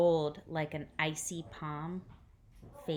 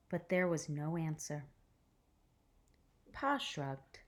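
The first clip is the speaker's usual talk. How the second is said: gentle read-aloud storytelling voice; hushed, careful picture-book reading; story narration; 6 dB louder than usual. A narrator's reading.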